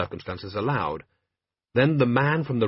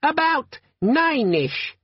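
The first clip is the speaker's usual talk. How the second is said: much higher squeaky chipper little voice; squeaky friendly demon; much slower than usual. Acted. Tiny demon answers Vimes about the time, squeaky and friendly. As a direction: sound annoyingly friendly.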